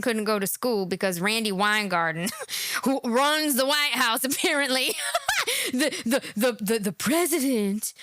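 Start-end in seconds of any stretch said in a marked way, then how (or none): 2.3-2.8 real laugh. Real, not acted. Laughing at guest. 5.0-5.7 real laugh. Real, not acted. Laughing at guest.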